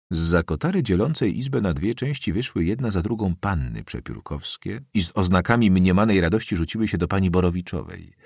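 ordinary delivery, nothing marked

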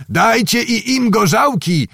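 high, projected command; calling an order. A narrator's reading. Zagłoba telling Cossacks to give peasants vodka, calling an order. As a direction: call it out high over the revelry.

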